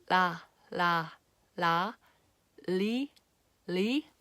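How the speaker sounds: "la" and "li" with the tongue tip pressed firmly behind the upper teeth then pulled back; slow clear Korean ㄹ syllables; Korean ㄹ demo. Shown as a demonstration. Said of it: "Do you see how my tongue".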